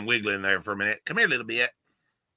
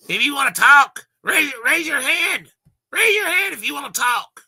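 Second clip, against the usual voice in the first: schoolteacher scolding; 14 semitones higher, 6 dB louder than usual. Retold or imitated. Mocking the lieutenant as a strict teacher, high mock shout. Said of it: "Remember when you were in school?".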